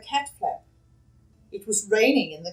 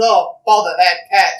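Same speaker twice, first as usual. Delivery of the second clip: grumpy exclamation; 2 semitones lower, 10 dB louder than usual. Acted. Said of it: "Mister Thomas said".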